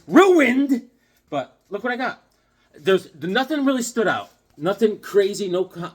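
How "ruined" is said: much higher, louder cry of ruined; exclaiming ruined. Real, not acted. Complaining his comic day got ruined, loud outburst. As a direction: blurt it out in outrage. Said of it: "my day got ruined".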